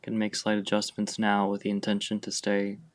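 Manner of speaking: ordinary delivery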